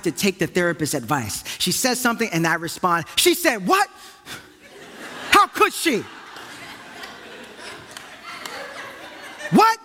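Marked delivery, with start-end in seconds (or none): 3.1-9.8 high-pitched indignant disbelief; hammed-up anger on someone's behalf; be theatrically mad along with her; mock outrage. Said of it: "enter into the anger and be mad with her".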